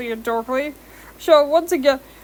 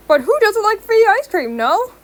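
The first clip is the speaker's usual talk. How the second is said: salesy pitch; 9 semitones higher, 5 dB louder than usual. A narrator's reading. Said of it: "he pitched".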